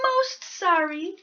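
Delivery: a tiny mouse politely angling for tea; polite little mouse. Acted. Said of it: "said the mouse".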